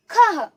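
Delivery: खह as high, loud "khaha"; खह short, ending on breath; visarga breath. Shown as a demonstration. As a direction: punch खह and release a half ह. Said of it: "कैसे इसका उच्चारण करेंगे".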